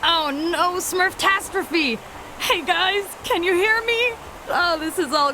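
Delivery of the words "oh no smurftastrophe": high, drawn-out dismay; dismayed cry. Acted. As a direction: lament a disaster, pitch raised. Dismay as things go badly wrong.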